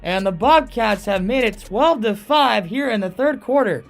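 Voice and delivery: loud, high goal call; long goal yell. Real, not acted. Goal call by play-by-play announcer.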